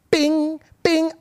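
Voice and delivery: high, ringing "bing"; imitated phone ping. Imitating the group chat on his phone, high ping. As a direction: sing out a notification chime.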